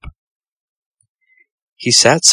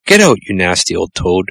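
plain reading voice

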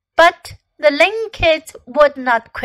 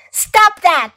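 raised, sharp command; crying out; 5 semitones higher, 5 dB louder than usual. A narrator's reading. Fox to wild Ling kids, commanding cry. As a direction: call out to halt unruly kids. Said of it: "cried Fox".

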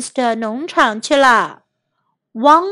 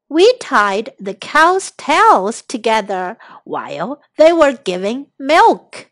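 ordinary delivery

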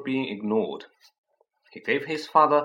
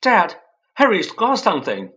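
loud, higher telling-on voice; tattling shout; 8 semitones higher, 10 dB louder than usual. Acted. Dudley tells his dad on Harry, loud and high. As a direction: call out to tell on Harry.

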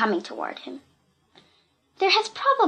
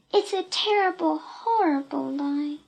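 sadder; 6 semitones higher than usual. A narrator's reading. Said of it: "still sadder".

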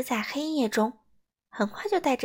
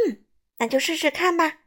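raised, higher agreeing voice; speaking loudly; 5 semitones higher, 5 dB louder than usual. A narrator's reading. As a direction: call out agreement to a companion. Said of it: "米歇尔大声说". (提高嗓门大声答应)

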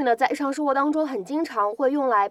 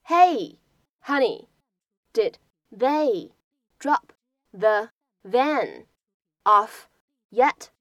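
slow, word by word with pauses; teacher pacing each phrase slowly; slow line breakdown; much slower than usual. Shown as a demonstration. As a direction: break the line into chunks for learners. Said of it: "有这样的几处发音技巧".

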